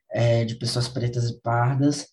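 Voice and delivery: usual speaking voice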